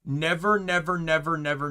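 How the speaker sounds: ordinary delivery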